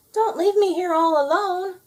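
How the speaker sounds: high pleading sheep voice. A narrator's reading. Sheep begging the animals not to leave her, high plea. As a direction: beg not to be left behind.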